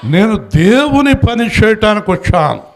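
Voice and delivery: loud proclamation. Shown as a demonstration. Preacher leading congregation to say it loudly, ringing proclamation. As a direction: shout it out for the whole church. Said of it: "గట్టిగా చెప్పండి".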